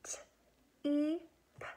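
cheap split into ch-, e-, p; sounding out words. Shown as a demonstration. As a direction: chop cheap into ch-, e-, p. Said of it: "let's get our choppers out".

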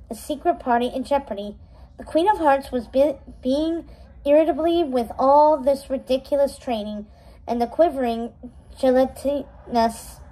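ordinary delivery

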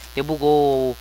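usual speaking voice

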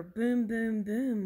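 loud, drawn-out booms; booming thunder. A narrator's reading. Reader makes thunder for children, booming. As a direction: let each boom roll like thunder. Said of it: "Mister Brown makes thunder".

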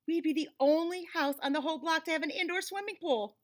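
ordinary delivery, nothing marked

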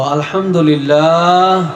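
slow, drawn-out loud Alhamdulillah; drawn-out loud praise called to the crowd. Real, not acted. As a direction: lead the crowd in a big shout of praise. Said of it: "চিল্লে আমরা সবাই বলবো".

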